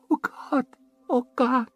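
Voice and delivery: put-on high, thin girl's voice calling on God; a scared child praying aloud; frightened little girl.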